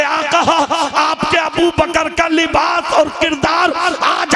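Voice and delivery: rising to a high, ringing climax; impassioned preaching. Real, not acted. Preacher voicing Gabriel's answer about Abu Bakr, impassioned preaching. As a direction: build to a full-voiced, drawn-out declamation.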